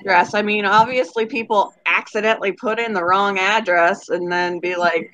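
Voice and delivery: usual speaking voice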